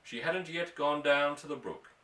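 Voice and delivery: ordinary delivery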